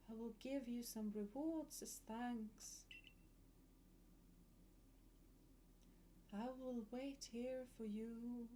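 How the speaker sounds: hushed and haunting, like a ghost; eerie voice. Acted. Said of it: "I try to do spooky voice".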